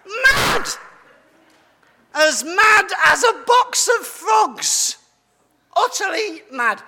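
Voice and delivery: a high, loud, manic shout of the word mad; hammed-up mad hatter. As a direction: play the Mad Hatter raving, hammed up for the kids. Kids' talk, Mad Hatter is famously mad.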